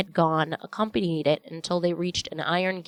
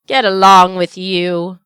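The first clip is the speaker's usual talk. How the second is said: louder, slow, playfully embarrassed; grinning retort; 12 dB louder than usual. A narrator's reading. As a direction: brush it off with a grin. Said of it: "retorted Peter, grinning".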